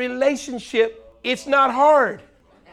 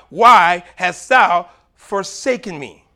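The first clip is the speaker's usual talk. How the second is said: louder, slow anguished call; anguished cry; 3 semitones lower, 8 dB louder, slower than usual. Acted. Jesus crying out on the cross, slow anguished plea. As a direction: plead it out slowly. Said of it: "Jesus would not have cried out".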